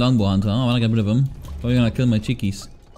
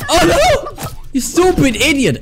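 high panicked yelp into laugh; panicking gamer; 14 semitones higher, slower than usual. Real, not acted. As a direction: shriek in gaming panic, laughing. Gamer getting killed in fight.

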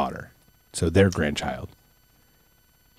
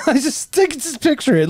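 laughing; 14 semitones higher than usual. Real, not acted. Laughing while asking to picture.

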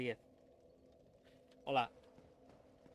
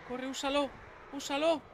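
urgent shout; 10 semitones higher than usual. Real, not acted. Urging himself in combat.

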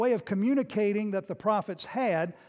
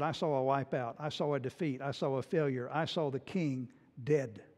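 lower, softer, heavy with doom; grave and serious; 7 semitones lower, 6 dB quieter than usual. Retold or imitated. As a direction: lay out the defeat gravely. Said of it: "he got real serious".

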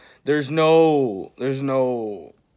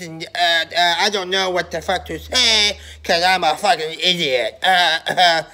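lightly voiced dumb-kid mockery; a hint of a childish stammering voice; mocking impression. Mocking the rival fan's way of talking, lightly childish. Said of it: "you sound like a six-year-old talking like this".

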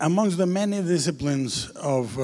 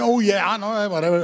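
folksy American voice, pitched up; hammed-up folksy chatter in a shaky attempt at an American accent; Mister Rogers; 6 semitones higher, 3 dB louder than usual. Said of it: "really folksy Mister Rogers voice".

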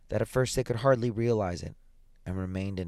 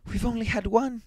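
put-on high, excited old woman; eager high-pitched insistence; rapid, eager reply; 14 semitones higher than usual. A narrator's reading. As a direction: press the point in a high, urgent voice. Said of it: "she replied rapidly".

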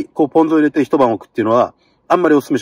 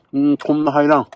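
ordinary delivery

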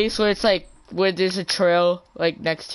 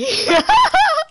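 high laugh; 7 dB louder than usual. Real, not acted. Laughing after spotting friend.

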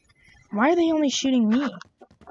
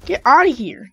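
loud, punchy get-outta-here; loud taunt; 4 semitones higher, 8 dB louder than usual. Real, not acted. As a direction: bark it at the enemy. Taunting enemy after a kill.

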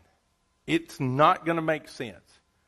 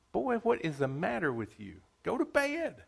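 a lightly voiced old priest, a higher dismissive bed line; sleepy old Eli; 4 semitones higher, 5 dB quieter than usual. Old Eli to young Samuel at night, a lightly voiced weary dismissal. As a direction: Eli brushing off the boy, sending him back to bed.

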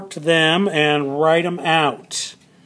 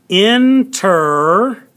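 "Interrupt" stretched out slowly and a bit louder, rising; slow model word; 3 semitones higher, 4 dB louder, much slower than usual. Shown as a demonstration. Teacher models the new word for the class, "Interrupt" slow and clear. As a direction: draw out "Interrupt" syllable by syllable. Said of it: "teacher models the word slowly".

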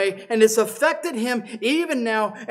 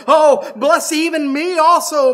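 loud, much higher pleading cry; bitter cry; 8 semitones higher, 9 dB louder than usual. Acted. Esau crying out to his father for a blessing. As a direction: beg like a son cheated of everything. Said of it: "an exceeding great and bitter cry".

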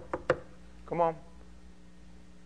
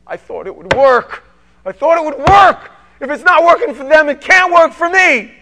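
loud, higher anguished inner cry; inner hurt voice; 11 semitones higher, 15 dB louder than usual. Acted. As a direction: cry out what the heart hides. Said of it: "what's going on in their heart".

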